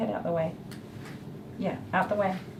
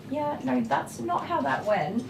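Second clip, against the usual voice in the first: mock dismissive; 7 semitones higher, 6 dB quieter, slower than usual. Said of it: "and I'm just like".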